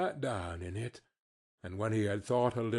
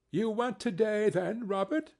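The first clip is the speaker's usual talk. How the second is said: high-pitched wife's question; wife asking; 9 semitones higher than usual. A narrator's reading. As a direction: ask it high, as Bob's wife. Wife questions Bob, voiced high.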